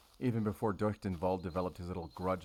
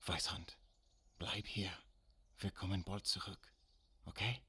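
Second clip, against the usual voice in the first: hushed, gentle instructions in German; soft aside in German; 8 dB quieter than usual. Acted. Telling Weisshund to stay and wait, softly. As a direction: speak softly, as to an animal left waiting.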